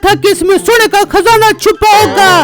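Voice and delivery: wife's voice, complaining. Acted. Wife complains to husband. (पत्नी की ऊँची आवाज़, शिकायत भरी)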